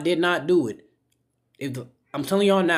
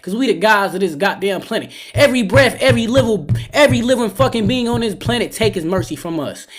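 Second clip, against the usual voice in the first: usual speaking voice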